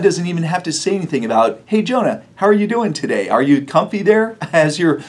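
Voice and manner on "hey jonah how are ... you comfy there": teasing. Retold or imitated. God teasing Jonah.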